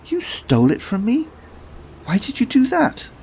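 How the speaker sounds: put-on a shocked young woman; indignant girl. Felicity indignant.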